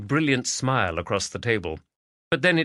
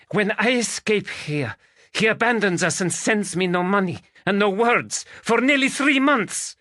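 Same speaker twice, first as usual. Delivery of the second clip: put-on high, accented and bitter, building in anger; angry Spanish lady; 12 semitones higher, 4 dB louder than usual. Magdalena raging to Stephen about her husband, high and accented.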